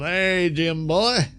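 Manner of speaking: relaxed country accent impression. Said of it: "he really falls into his country accent".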